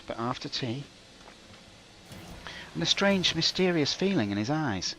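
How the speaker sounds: pompous storybook narration; melodramatic narration. Pretend. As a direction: spin a mock-tragic tale.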